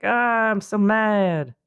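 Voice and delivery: slow, put-on grumbling shark voice; mad shark. Shark complaining about the teenagers, slow put-on grumble. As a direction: drag it out like a sulky shark. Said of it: "the shark is like".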